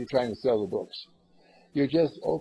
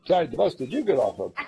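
loud, higher mock challenge; acted brash retort; 4 semitones higher, 8 dB louder than usual. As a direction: imitate a caller sounding off loudly. Acts out a grumbling caller's retort.

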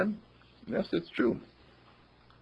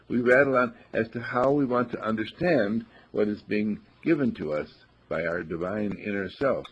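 ordinary delivery

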